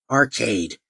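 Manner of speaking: Arcade? sneered with a falling scoff; mocking. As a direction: drop Arcade? like it's a silly word. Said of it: "with an unimpressed yet mocking tone".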